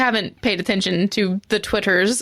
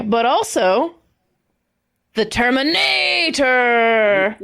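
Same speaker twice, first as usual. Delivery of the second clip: announcer roll call; much slower than usual. Acted. Reads out horse names slowly.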